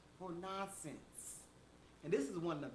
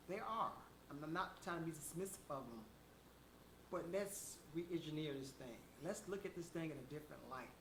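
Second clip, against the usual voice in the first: usual speaking voice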